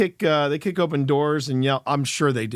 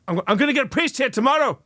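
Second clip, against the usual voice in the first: usual speaking voice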